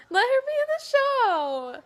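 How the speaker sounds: high, loud, crying demand; tearful plea. Real, not acted. Pleading with Souther to let her march. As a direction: beg through tears for her to march.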